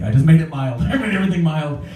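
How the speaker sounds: normal talking voice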